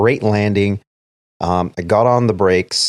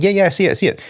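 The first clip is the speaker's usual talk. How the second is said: hurried yes; 11 semitones higher, much faster than usual. Retold or imitated. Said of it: "I'm like".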